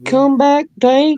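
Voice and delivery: falsetto plea to come back; high pleading call. Pretend. Begging Tank to come back.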